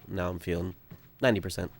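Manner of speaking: normal talking voice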